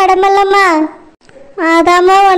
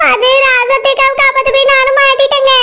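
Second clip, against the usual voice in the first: a pleading wife's voice, put on; 6 semitones higher than usual. Wife pleads with disbelieving husband, put-on voice. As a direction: plead your case to a doubting husband.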